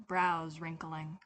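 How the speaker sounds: usual speaking voice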